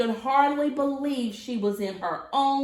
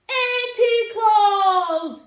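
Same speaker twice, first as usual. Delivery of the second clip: high, loud, slow call of the name; joyful shout; 9 semitones higher, 9 dB louder, much slower than usual. Acted. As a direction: shout out in delight. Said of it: "Sophie shouted".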